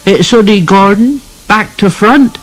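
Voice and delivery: put-on boy correcting his friend, smug child voice; scornful little boy. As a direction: put him right like a smug small boy. Second boy corrects the first.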